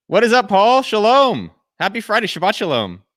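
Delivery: high, loud hype welcome; excited greeting. Real, not acted. Host greeting the guest on air, high and loud. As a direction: bounce the hello up high and loud.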